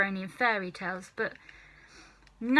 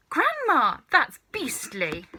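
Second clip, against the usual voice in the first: higher, louder cry on Grandma; appalled; 5 semitones higher, 5 dB louder than usual. Acted. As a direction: cry out in disgust. George disgusted.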